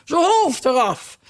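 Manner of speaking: high, slow queen's order; queen shouting. A narrator's reading. Queen shouting at the players, high and slow. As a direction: bellow the sentence. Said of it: "en roepen".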